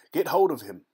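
high, loud cry. Acted. Pursuer shouts to seize Nunez. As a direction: shout the order to grab him. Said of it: "cried one".

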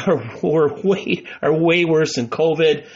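ordinary talk

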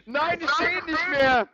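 high, cackling game rage; manic laughing yells. Real, not acted. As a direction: laugh and yell at the screen in a frenzy. Gaming frenzy, laughing and yelling.

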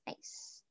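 "ice" said just like the English word; pronunciation demo. Shown as a demonstration. Teacher shows German Eis, demonstrating pronunciation. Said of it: "You pronounce it as".

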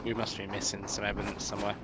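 usual speaking voice

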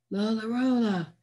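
acted breathless calling. A narrator's reading. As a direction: call out weakly for someone, short of breath. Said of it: "called out in a breathless voice".

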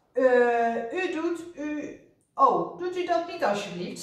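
high, halting plea; pleading. A narrator's reading. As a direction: beg the inspector, flustered and stumbling. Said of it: "smeekte zuster Snijwiet".

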